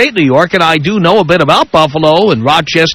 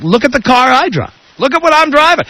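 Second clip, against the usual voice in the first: boastful guy; 8 semitones higher than usual. Retold or imitated.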